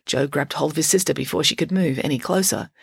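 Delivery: plain reading voice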